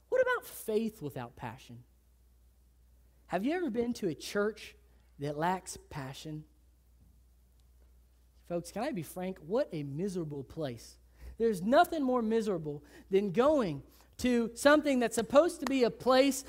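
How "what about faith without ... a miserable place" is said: low, hushed solemnity. Real, not acted. Preacher to congregation about passionless church, low and soft. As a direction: drop low and soft, grave. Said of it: "sermon, dropped low and soft".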